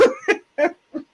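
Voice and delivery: high-pitched overwhelmed laugh. Real, not acted.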